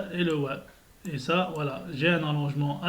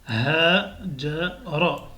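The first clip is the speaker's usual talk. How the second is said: syllabes lues très lentement; slow reading; much slower than usual. Shown as a demonstration. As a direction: lis chaque syllabe doucement. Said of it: "il faut vraiment lire doucement". (lecture lente et détachée)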